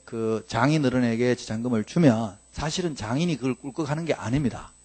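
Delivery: ordinary delivery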